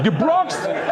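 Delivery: half-hearted retold scream, falling; mild mock yell. Husband yelling at his wife again, mild mock yell. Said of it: "He screams at her".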